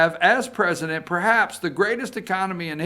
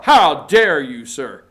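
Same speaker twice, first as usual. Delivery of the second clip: louder, hammed-up offended snap; mock outrage; 3 semitones lower, 9 dB louder than usual. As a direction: play the scandalised listener.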